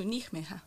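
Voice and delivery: Nijmegen misread Spanish-style, "neekh-MEH-ha"; mistaken pronunciation. Retold or imitated. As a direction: say Nijmegen as a newcomer guessing, "neekh-meha". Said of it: "you don't know for real how".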